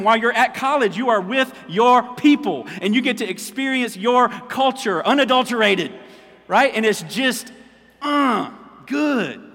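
loud, high, ringing sermon voice; preaching at full force. Acted. Pastor stirring up his congregation, loud and high. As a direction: preach it out big to the room.

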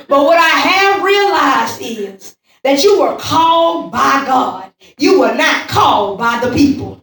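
loud, driving sermon cadence; full-force preacher building through repetition; emphatic preaching. Real, not acted. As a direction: drive the point into the congregation.

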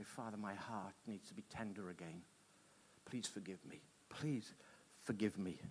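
soft, low, pleading prayer voice; quiet prayer. Acted. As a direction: pray it humbly and softly. Leads a prayer.

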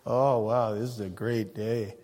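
low, mumbled mock enthusiasm; flat sarcastic drone. Preacher mocking a bored Elizabeth, low and flat. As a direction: say it like it's the dullest day ever. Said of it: "Try and say that without a smile on your face".

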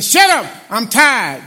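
loud, high angry outburst, imitating himself; snapping at the kids. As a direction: bark it like a tired, irritated dad. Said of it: "and I'm like".

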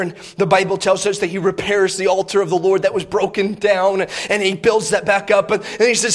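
usual speaking voice

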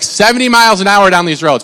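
usual speaking voice

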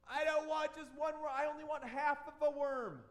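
put-on high, loud whiny kid voice; the preacher voicing Robbie's tantrum, high and whiny; whining child. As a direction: whine it like a cranky five-year-old.

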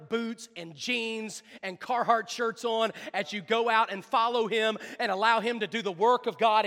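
loud, high sermon climax; full-force preaching. Real, not acted. Preacher urging people to follow him, full-force preaching. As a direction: preach it out to the whole room.

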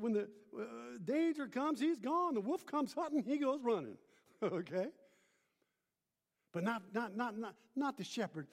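ordinary talk

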